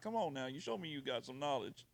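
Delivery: ordinary delivery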